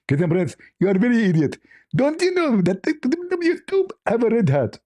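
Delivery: high put-on voice of an angry caller; high scolding impression voice; mock Zakir Naik. S0 acts out Zakir Naik phone call.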